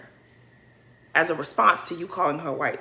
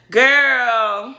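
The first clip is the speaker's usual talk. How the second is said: usual speaking voice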